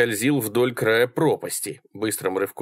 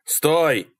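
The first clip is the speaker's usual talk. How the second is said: higher, urgent cry; raised-pitch yell; yelling after her; 6 semitones higher than usual. A narrator's reading. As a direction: call out to stop someone leaving. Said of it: "завопил Малькольм".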